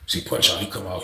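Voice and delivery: ordinary talk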